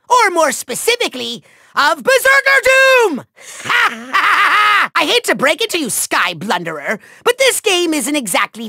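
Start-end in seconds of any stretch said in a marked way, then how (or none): 3.6-4.9 long, high villain cackle; evil cackle. Acted. Villain gloats over a trick up his sleeve, maniacal laugh. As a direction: cackle triumphantly, drawn right out.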